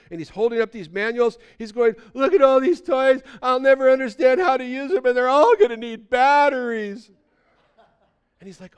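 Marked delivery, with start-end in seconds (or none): 2.1-7.1 high, loud hammed-up bawling; mock wailing. Pessimist kid among his birthday toys, mock wailing. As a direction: wail it like a child in a tantrum. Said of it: "crying and weeping and wailing".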